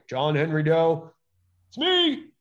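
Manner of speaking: hammed-up clueless defendant; mock naive defendant. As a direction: play the clueless guy blurting his name. Mocking a naive defendant in court.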